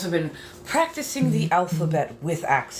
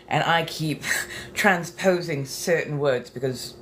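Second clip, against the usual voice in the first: ordinary talk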